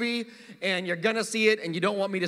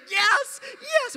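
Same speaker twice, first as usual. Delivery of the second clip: a much higher pretend victory yell; imagined cheer in the theatre; mock cheering. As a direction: jump up and cheer like mad. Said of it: "we stand up and we're like".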